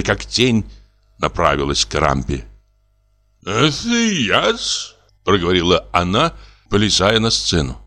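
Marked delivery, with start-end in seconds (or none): 3.4-4.9 prompter's voice. A narrator's reading. Prompter's timid answer.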